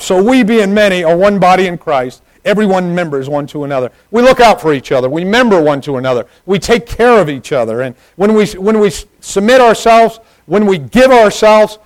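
high, loud pulpit preaching; full-force preaching. Real, not acted. Preacher urging the church to serve, preaching loudly. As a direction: drive it out to the whole congregation, high and loud.

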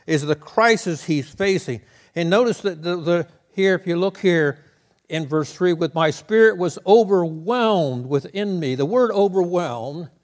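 loud preached reading; preaching. Acted. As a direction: carry the verse out with force. Preaching at full force.

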